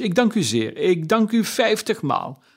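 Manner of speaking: effusive thanks. A narrator's reading. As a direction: pour out thanks, building. Scrooge overflowing with thanks.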